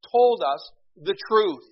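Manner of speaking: high, loud and slow, every word weighted; emphatic declaration. Real, not acted. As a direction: proclaim it from the pulpit. Preacher drives home key point.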